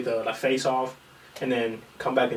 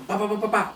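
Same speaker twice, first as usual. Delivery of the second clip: punch sounds; 8 semitones higher, faster than usual. Retold or imitated. Imitating punches in fight.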